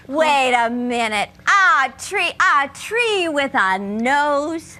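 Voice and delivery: slow, low, doubtful; suspicious. Acted. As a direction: stretch it out, unconvinced. Suspicious questioning of wolf.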